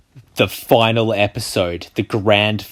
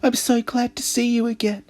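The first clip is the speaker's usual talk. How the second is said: man doing a woman's voice; 14 semitones higher than usual. Acted. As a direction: voice the sister sweetly. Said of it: "I should do the girl voice".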